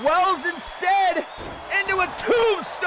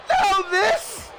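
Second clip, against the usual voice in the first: ordinary talk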